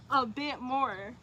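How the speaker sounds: slow, dragged-out repeat; drawn-out echo. Real, not acted. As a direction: stretch the words out wistfully.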